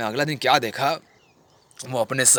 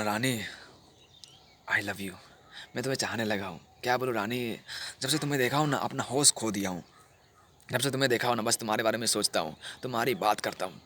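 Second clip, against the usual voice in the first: soft, low declaration of love; tender confession; 3 semitones lower, 6 dB quieter than usual. Acted. Lover confesses to Rani, softly and tenderly. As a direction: confess love gently and quietly.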